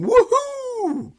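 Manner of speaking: high whoop of joy; a high delighted woohoo; cheering. A narrator's reading. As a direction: cheer it like a kid. Plan working cheer.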